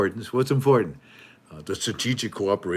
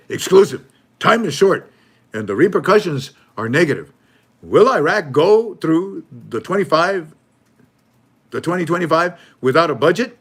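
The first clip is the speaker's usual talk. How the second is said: hammed-up news hype; 7 semitones higher, 9 dB louder than usual. Mocking the news channel's alarmist headline, hammed-up loud and high. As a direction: sell the doom like a tabloid anchor. Mock breaking news read.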